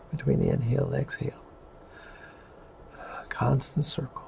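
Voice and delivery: soft, fading into a whisper; guided meditation. Acted. Leads a breathing meditation.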